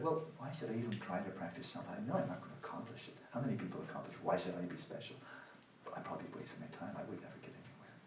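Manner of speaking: hushed, defeated self-talk, imitated; discouraged inner voice. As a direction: voice the lazy doubter under your breath. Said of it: "The sense that".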